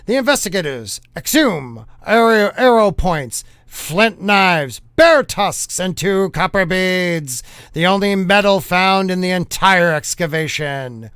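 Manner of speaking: put-on punchy old-time newsreel announcer; hammed-up fifties newscaster read; 1950s news voice. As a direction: proclaim it like a vintage news bulletin. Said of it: "read it in a nineteen fifties news style voice".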